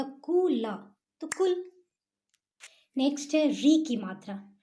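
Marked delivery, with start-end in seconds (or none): 0.0-1.7 कु ल split, then कुल joined higher; sounding out then joining. Shown as a demonstration. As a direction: break कु ल apart then blend into कुल. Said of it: "और यदि दो रहेगा".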